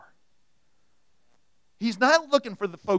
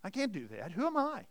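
high, soft self-doubting question; mock-helpless, pitch jumping up on the question; 5 semitones higher, 6 dB quieter than usual. Preacher voices Joshua doubting his call, self-doubting. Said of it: "Joshua asks the same question every one of us".

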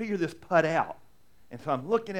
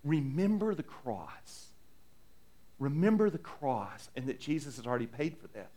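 gentle reminder; 6 dB quieter than usual. Retold or imitated. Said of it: "he comes alongside and says".

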